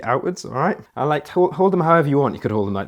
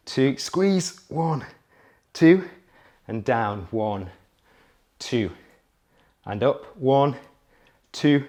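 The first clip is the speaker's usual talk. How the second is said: coach counting reps; much slower than usual. Acted. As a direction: call out each rep like a trainer.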